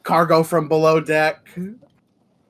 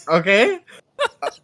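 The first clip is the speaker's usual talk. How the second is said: teasing chef; 4 semitones higher, 5 dB louder, faster than usual. Acted.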